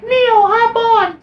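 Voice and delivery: very high, loud, slow call; high shout. Retold or imitated. Trinity calling Neo onto her motorcycle, high loud call. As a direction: yell it from a speeding motorcycle. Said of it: "is like".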